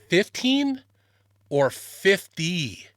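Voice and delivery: FIFTEEN pitched high, then FIFTY drawn out; puzzled contrast. Retold or imitated. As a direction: question "Fifteen" against "fifty", stretching each apart. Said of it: "the same thing I misheard".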